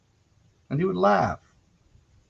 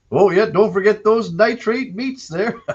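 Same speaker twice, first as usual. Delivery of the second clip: slow, higher and louder teasing prairie dad; dad impression, prairie accent; 10 semitones higher, 6 dB louder than usual. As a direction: drag out the tease like a gleeful father. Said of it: "I can give you a pretty good impression".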